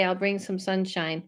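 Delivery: usual speaking voice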